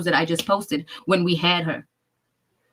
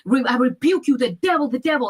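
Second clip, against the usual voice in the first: mock preacher rebuke; 6 semitones higher than usual. Grandmother rebuking devil.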